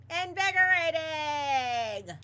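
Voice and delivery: slow, high, loud drawn-out yell. Acted. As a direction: stretch the word into a loud high shout. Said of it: "Lewis is yelling".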